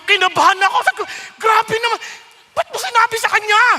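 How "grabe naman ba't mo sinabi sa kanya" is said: high, loud, fast panicked plea to God; a startled man blurting his alarm; panicked whisper-prayer. Retold or imitated.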